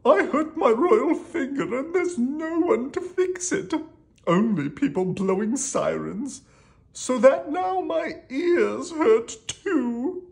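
high, whining royal complaint; hammed-up high king voice, drawn out at the end; crying king. A narrator's reading. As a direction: wail about your troubles as a storybook king. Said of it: "cried the king".

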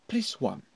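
"press one" lifted and rolled over; voice rolls over. Shown as a demonstration. Teacher demonstrating a rolling phone-menu prompt. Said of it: "Can you hear my, my voice roll over".

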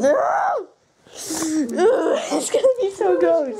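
high shout, then slow groan; panicked shout. Real, not acted.

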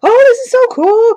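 put-on high, loud excited squeal in a young voice; son's excited squeal. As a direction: gush like a thrilled kid. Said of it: "he got all excited".

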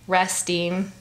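plain reading voice

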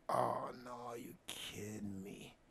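slow, very soft "you kidding me"; disbelieving whisper. Retold or imitated. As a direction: mutter it in dismay.

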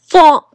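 a loud smacking thump sound. Acted. Ball crashing into the castle, loud thump. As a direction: punch the noise out.

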